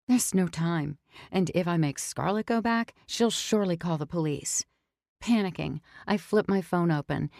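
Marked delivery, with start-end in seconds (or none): none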